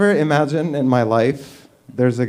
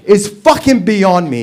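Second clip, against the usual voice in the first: louder, high, laughing rant; louder indignant laughing rant; 5 semitones higher, 6 dB louder than usual. Real, not acted. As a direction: let the rant build loud and high. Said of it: "That's my rant".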